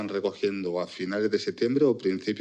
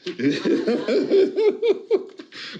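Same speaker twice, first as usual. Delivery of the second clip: high laughing burst. Real, not acted.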